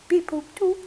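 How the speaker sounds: high robotic beep-boop imitation. Therapist imitating the droid's beeps for Leia. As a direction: chirp it like a little droid. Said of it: "R two D two?".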